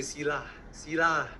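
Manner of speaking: Malaysian English with lah at the end; Malaysian accent. Retold or imitated. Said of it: "Malaysian, we say".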